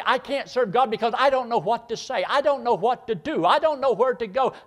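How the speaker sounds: high, loud complaining believer; whining doubter. Retold or imitated. Preacher mimicking a hesitant believer's excuses, high and whining. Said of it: "You say".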